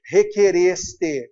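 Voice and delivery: Requereste said slowly, each syllable clear; slow careful model form. Shown as a demonstration. Teacher slowly models Requereste for the class. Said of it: "com o verbo requerer é o quê?".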